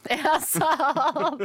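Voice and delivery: laughing through the words. Real, not acted. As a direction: giggle it out. Laughing while repeating a crude line.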